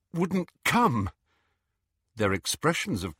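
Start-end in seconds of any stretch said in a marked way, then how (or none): 0.1-1.1 raised, winded gasp; panting. A narrator's reading. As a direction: push it out while winded. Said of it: "he panted, clutching the stitch in his side".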